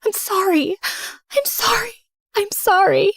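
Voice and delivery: high, pleading sorry through tears; crying apology. Acted. Rose sobbing apologies to Jessica, high and pleading. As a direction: beg for forgiveness, voice high. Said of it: "I was crying hard".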